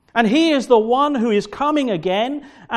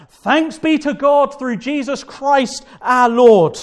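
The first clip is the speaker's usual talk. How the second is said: loud, slow, ringing praise; joyful shout; 4 semitones higher, 7 dB louder, slower than usual. Retold or imitated. Preacher voicing Paul shouting thanks to God, loud and slow. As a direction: proclaim it like a shout of relief. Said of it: "Paul immediately shouts out".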